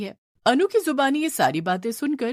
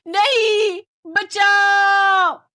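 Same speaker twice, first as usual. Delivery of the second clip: much higher, louder scream for help; terrified cry for help; 13 semitones higher, 6 dB louder, much slower than usual. Acted. Family crying for help as the ghosts surround them, terrified.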